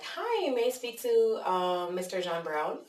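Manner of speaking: high, drawn-out polite caller greeting; phone greeting. Retold or imitated. Caller asking for a customer on the lead list, polite phone greeting. Voicing own cold-call opener.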